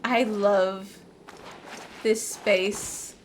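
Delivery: ordinary talk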